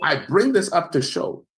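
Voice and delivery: ordinary talk, nothing marked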